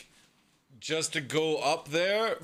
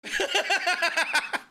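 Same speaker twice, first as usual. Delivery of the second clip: high staccato laugh; 14 semitones higher than usual. Real, not acted. Viewer watching a plane come in, high laugh. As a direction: let out a high, rapid cackle. Amused by the flying.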